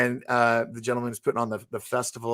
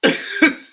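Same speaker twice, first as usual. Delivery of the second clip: very high, loud strangled squeal; comic high-pitched noise of panic; mock panicked squeal; 8 dB louder than usual. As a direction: squeak helplessly in place of an answer. Acts his speechless panicked reaction.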